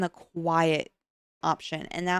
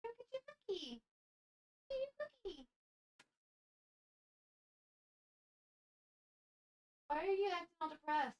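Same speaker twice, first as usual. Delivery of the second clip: coaxing a dog; 11 semitones higher, 14 dB quieter, faster than usual. Real, not acted. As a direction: sweet-talk the dog softly.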